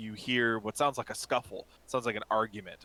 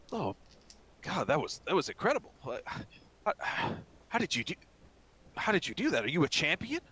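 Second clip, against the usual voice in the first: higher-pitched, halting amazement; awestruck timid shopkeeper; 5 semitones higher than usual. Acted. Scared shopkeep to his rescuer, amazed. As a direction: stammer out your wonder, questions lifting high.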